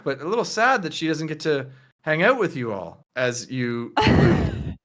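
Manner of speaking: politely chatty with a hesitant start; lifted voice, fussing a little; Tina. Acted. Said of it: "Tina says".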